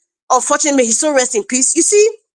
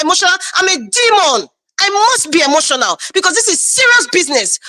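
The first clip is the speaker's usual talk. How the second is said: usual speaking voice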